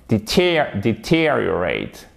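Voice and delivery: "deteriorate" slow, stretched second syllable; stress on TER. Shown as a demonstration. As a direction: punch TEER in "deteriorate", then fall. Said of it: "vous mettez l'accent tonique ici".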